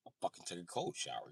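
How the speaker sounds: usual speaking voice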